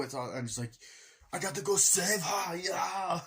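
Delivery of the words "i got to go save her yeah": high, hammed-up action hero; put-on rescue declaration, pitched up; mock hero urgency. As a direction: imitate an urgent movie hero.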